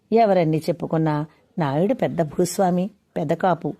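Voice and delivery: plain reading voice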